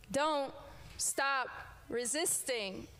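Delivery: ordinary talk, nothing marked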